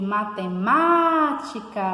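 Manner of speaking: drawn-out calling of "matemática", peaking on "má"; stressed syllable demo. Shown as a demonstration. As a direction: swell and hold the "má" in "matemática". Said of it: "que é a sílaba má".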